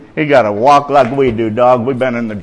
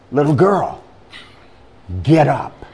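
Jesus' command. Acted. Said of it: "he said, you ready?".

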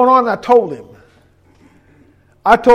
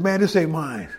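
mild retold crying; 4 semitones lower, 7 dB quieter than usual. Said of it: "because I'm crying".

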